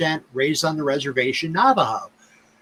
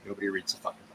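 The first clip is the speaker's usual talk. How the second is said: a fast, bitter aside under the breath; muttered aside; 10 semitones lower, 16 dB quieter, much faster than usual. Real, not acted. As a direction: drop much softer and rush it.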